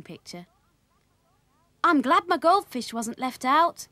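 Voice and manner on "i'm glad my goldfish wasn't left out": put-on high, raised, pleased boy; pitched-up child character; young Willie's voice. A narrator's reading. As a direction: chirp it happily in a higher voice. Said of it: "said Willie".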